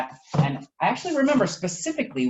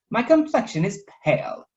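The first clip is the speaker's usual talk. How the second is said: higher, fretful knightly voice; Sir French Toast fretting; 9 semitones higher, 4 dB louder than usual. Acted. Toast frets to Pancake, high and fretful. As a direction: fuss over his pale look. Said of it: "said Toast".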